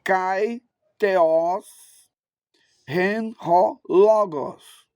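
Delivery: deliberate, separated Greek words; measured classroom recitation of ancient Greek; Erasmian Greek pronunciation. Shown as a demonstration. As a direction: read the Greek word by word for learners. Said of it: "Na pronúncia erasmiana".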